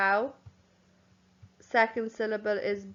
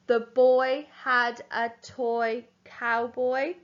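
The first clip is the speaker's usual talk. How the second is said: slow, even dictation pace. Shown as a demonstration. Teacher dictating the sentence slowly to the class. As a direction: read each word for pupils to write. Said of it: "So capital T".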